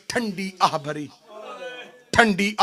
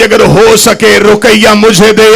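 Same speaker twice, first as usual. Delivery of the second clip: weeping cry; 3 semitones higher, 12 dB louder than usual. Acted. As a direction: plead through tears at full voice. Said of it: "रो के कहती".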